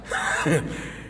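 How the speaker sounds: a breathy unvoiced chuckle. Real, not acted. Laugh mid story. (tawa kecil tanpa suara)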